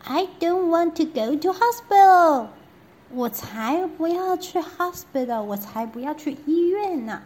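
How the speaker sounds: high, loud whining refusal; princess refusing. Acted. Princess refusing to go to hospital, high and loud. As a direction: wail it like a stubborn child. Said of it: "小公主说".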